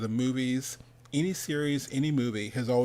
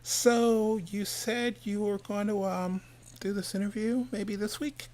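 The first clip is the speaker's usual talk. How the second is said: higher, slow, tentative fan voice; a hesitant, higher reminder; sheepish nudge; 9 semitones higher than usual. Retold or imitated. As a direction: nudge her timidly, slow and high. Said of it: "and I'm like".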